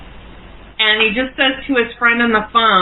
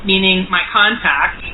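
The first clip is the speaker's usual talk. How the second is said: ordinary talk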